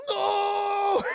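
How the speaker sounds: high, loud, drawn-out mock wail; anguished shout. Scotsman sees his bagpipes stabbed, hammed-up anguish. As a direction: cry it out in melodramatic horror.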